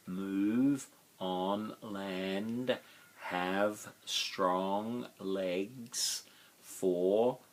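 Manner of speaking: each word stretched long and spaced; very slow and drawn out. Shown as a demonstration. Teacher to class, stretching each word very slowly. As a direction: spell the phrase out syllable by syllable. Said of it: "one syllable".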